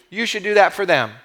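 ordinary talk, nothing marked

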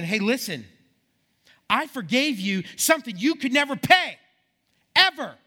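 loud, high-pitched indignation, building; angry king. Acted. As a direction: build to a shouted last word. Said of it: "I'm just angry at this guy".

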